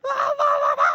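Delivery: high, loud squealing la la la; overjoyed at a text from someone she likes, squealing; giddy squeal. Retold or imitated. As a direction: burst into a shrieking happy singsong. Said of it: "and I'm like".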